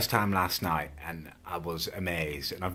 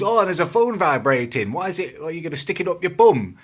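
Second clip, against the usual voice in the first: mocking the bloke; 9 semitones higher, 6 dB louder than usual. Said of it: "And the bloke goes".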